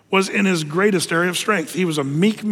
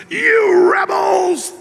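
slow, high, loud furious shout; angry Moses; 12 semitones higher, 5 dB louder, much slower than usual. Retold or imitated. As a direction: scold the crowd at the top of the voice. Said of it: "He's, he's ticked".

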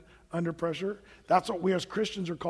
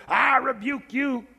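slow, higher, dramatic "I rebuke you"; mock rebuke; 5 semitones higher, 4 dB louder, slower than usual. Contrasting a showy rebuke with Michael's calm one, slow and dramatic. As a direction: ham up a showy exorcist rebuke. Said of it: "He doesn't say".

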